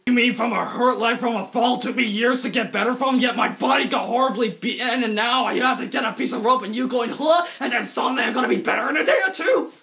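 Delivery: low, gruff Batman; mock Batman voice; Batman growl. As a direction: growl like the hero. Bruce Wayne complains.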